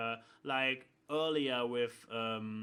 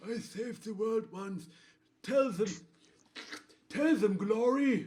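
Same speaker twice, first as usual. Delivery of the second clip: junkie Blackjack voice; 6 semitones higher, 5 dB louder than usual. Acted. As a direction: play a burned-out addict rambling. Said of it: "this is my old junkie Blackjack voice".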